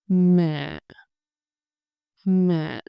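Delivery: MẸ said as mả, low and dipping, no final rise. Shown as a demonstration. Teacher shows students thanh hỏi, MẸ as low dipping mả. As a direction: let MẸ drop and stay low. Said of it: "It's a low, short, dipping sound".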